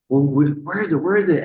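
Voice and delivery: high, urgent repeated question; a producer frantically asking where the oxygen went; panicked demand. Retold or imitated. Producer urgently asking crew about the oxygen, high and alarmed.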